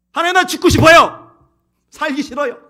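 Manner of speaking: high, loud acted cry of despair; desperate outburst. Preacher as Moses telling God he wants to die, desperate cry. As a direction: burst out as if at breaking point.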